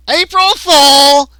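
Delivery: high, loud drawn-out cry; gleeful alien prankster. Acted. Gray springing his prank on captives, high loud gleeful cry. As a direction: stretch it out as a big prank reveal.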